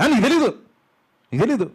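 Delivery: imitating Joab snapping back at David in anger; Joab's angry retort. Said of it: "అతను చాలా కోపంతో మాట్లాడుతున్నాడు".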